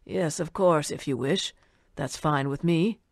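a lightly voiced old man musing. A narrator's reading. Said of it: "the old man said".

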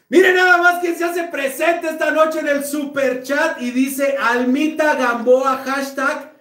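loud hype announcer call; excited shout-out. Real, not acted. Shouting out a super chat donor.

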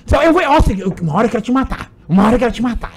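mock mom yelling at her son; imitating his angry mom. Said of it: "como que tua mãe faz?".

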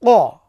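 ক said thick from the back of the throat; heavy qa. Shown as a demonstration. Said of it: "মোটা".

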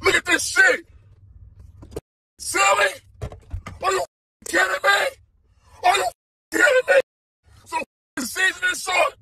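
can't believe what's in the box; outraged disbelief. Real, not acted.

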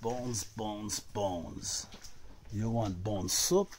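ordinary talk, nothing marked

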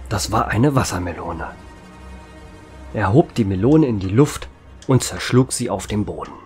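usual speaking voice

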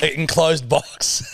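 drawn-out, relishing delivery; slow savoring. Pretend. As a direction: linger on each word to gross her out. Savoring gross detail.

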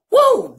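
excited woo. Real, not acted. Hobo host cheering his own O G wordplay. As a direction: whoop it up.

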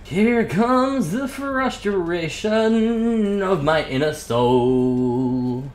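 slow mock-epic narrator voice; mock dramatic. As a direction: drag it out like a movie trailer.

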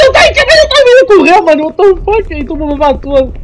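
high, loud frantic yelling; panicked shouting. Real, not acted. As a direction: shout while fleeing in terror. Monster running after them.

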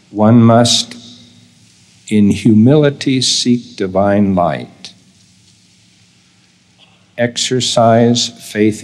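measured, deliberate reading for note-takers; slow dictation. Real, not acted. Speaker reads key statement so listeners can take notes, slowly. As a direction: read slowly, with long pauses between phrases. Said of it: "I'm going to read it slowly".

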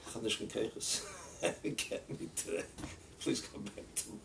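hushed, drained retold plea; imitating weary exhaustion. Rabbi asks waiting crowd to leave, imitating weary exhaustion. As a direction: let the voice fade to near whisper, as if spent.

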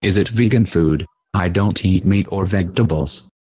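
normal talking voice

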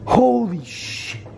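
slow, high awestruck curse; stunned disbelief. Real, not acted. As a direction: let it drop out slowly, stunned. Reacting to a ghost sighting.

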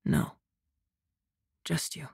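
very quiet, low reply; soft murmur. A narrator's reading. Man answering the drowsy woman he holds, murmuring softly. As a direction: murmur it tenderly. Tender answer as she dozes off.

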